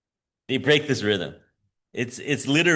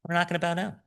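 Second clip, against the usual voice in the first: casual shrug-off; 6 semitones higher, 5 dB quieter than usual. Retold or imitated. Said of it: "They just say".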